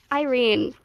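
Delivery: usual speaking voice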